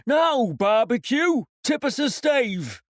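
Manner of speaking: a rowdy sailor hollering across deck; sailor crying out. Acted. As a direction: call to the cook for a song. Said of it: "cried one voice".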